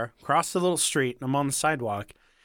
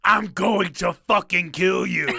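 loud, drawn-out threatening yell; retold yell; 5 semitones higher, 6 dB louder than usual. Homeless man threatening the speaker up close, imitated yell. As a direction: yell it slowly, each word stretched.

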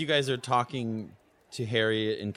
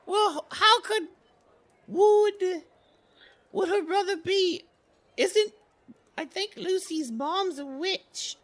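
[name]'s voice; 8 dB louder than usual. Acted. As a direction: think it through aloud like a young witch. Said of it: "[name] goes".

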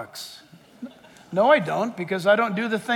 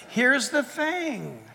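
higher, louder, driving the point home; fiery preaching; 7 semitones higher, 4 dB louder than usual. Acted. Pastor warns his listeners their souls matter more than masks, rising preaching voice. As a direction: preach it with rising fervour over the music.

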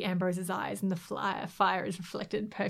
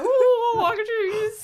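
much higher, whiny excited squeal; mock eager hero; 14 semitones higher, 6 dB louder than usual. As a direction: ham up a delighted little cry. Voicing a hero's sudden idea.

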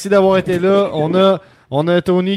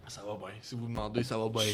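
quiet; 7 semitones lower, 19 dB quieter than usual. Real, not acted. Speaking low during call.

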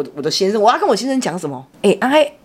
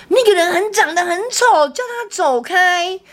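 imitating toddler; 9 semitones higher, slower than usual. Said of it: "他说".

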